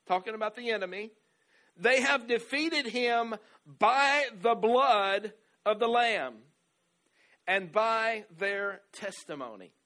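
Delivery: slow, loud, high proclamation; emphatic scripture reading. Acted. Pastor reading the verse to the church, slow and emphatic. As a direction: lean on each word of the verse.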